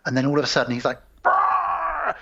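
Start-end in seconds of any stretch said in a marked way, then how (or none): 1.2-2.1 high mock zombie shriek; infected snarl. Father turning infected, high mock shriek. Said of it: "starts freaking out".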